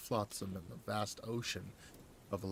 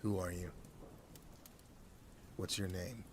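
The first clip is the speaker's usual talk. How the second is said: low, softer, close to the ear; breathed; 3 semitones lower, 10 dB quieter than usual. A narrator's reading. Narrator breathing into hostage's ear, soft and low. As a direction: breathe the question into his ear. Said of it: "leaned in close behind him and breathed".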